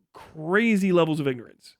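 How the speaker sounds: normal talking voice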